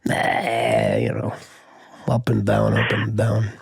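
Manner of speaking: a lightly voiced grumpy dad, low and mumbled; bad dad. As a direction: gripe like a sour, crude father. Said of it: "bad dad".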